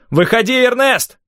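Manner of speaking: loud, high, drawn-out summons; shouting. A narrator's reading. Rafael pounding on the doctor's door, shouting. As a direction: shout through a closed door. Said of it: "крикнул он".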